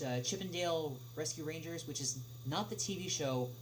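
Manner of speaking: ordinary talk, nothing marked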